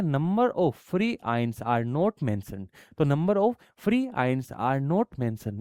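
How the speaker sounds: dictating a rule at half speed; slow dictation. Shown as a demonstration. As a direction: spell out the rule slowly for students to copy. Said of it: "क्या बोला गया है?".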